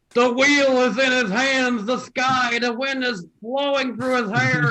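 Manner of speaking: high, slow dramatic narration; dramatic narrator. Pretend. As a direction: paint the scene like a movie trailer. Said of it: "I just wanna do this impression".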